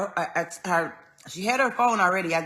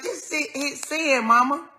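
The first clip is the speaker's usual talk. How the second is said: coaxing mom; 7 semitones higher than usual. Retold or imitated. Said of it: "I'm like".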